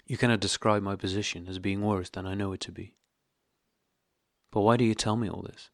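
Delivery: usual speaking voice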